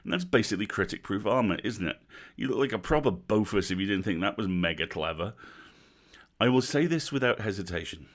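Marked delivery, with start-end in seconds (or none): none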